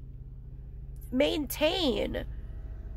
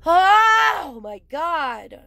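higher and louder groaning oh; exasperated oh; 4 semitones higher, 7 dB louder, much slower than usual. Real, not acted. Fed up with posers, loud oh. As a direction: let out a fed-up cry.

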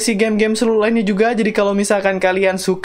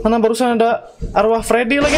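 usual speaking voice

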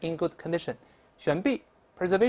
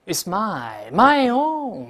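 a hint of greedy Gollum, rising loud on my own; 3 semitones higher, 5 dB louder, much slower than usual. Teacher imitating Gollum clutching the ring, greedy rising cry. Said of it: "很多人贪婪地拿着这个戒指".

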